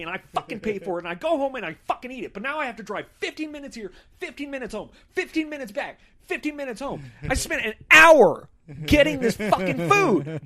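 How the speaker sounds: high-pitched, fast, escalating rant; imagined furious tirade. Retold or imitated. Customer fantasizing about berating restaurant staff, acted-out furious tirade. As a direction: tear into the staff, piling up grievances to an exasperated peak. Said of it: "rip somebody's head off".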